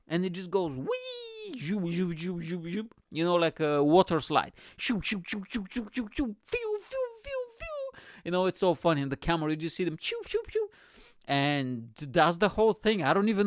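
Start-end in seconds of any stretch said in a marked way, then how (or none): 0.1-2.9 whooshing zoom sound. Retold or imitated. Imitating the sled speeding by. 4.8-8.0 rising whoosh noises. Retold or imitated. Imitating the bobsled whooshing past. 10.3-10.7 high whoosh. Retold or imitated. Imitating riders whooshing past.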